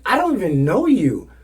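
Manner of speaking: high, loud mock exasperation; imitated indignant retort. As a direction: act out an outraged rejection. Woman retorting in acted quarrel.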